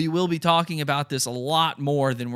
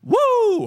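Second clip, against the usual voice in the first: very high shouted woo, hammed up; 6 dB louder than usual. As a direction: yelp it like a bad impression. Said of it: "Listen to this".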